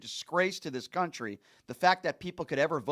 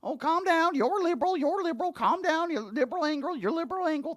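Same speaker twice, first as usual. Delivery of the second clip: much higher, sing-song dismissive mock voice; mocking James Comer; 11 semitones higher than usual. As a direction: parody a politician waving off a reporter. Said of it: "And James Comer's response is".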